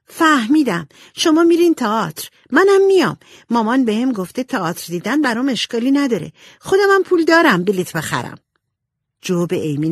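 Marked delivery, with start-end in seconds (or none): 0.1-8.4 put-on high, louder excited girl; bursting with discovery. A narrator's reading. Amy guesses her sisters are going to the theatre, excited. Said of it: "با هیجان گفت".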